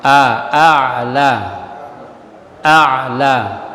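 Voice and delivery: a'la with a voiced throat ain after the a. Shown as a demonstration. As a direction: squeeze the middle of a'la in mid-throat. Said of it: "a dengan ain". (a'la dengan ain dari tengah tenggorokan)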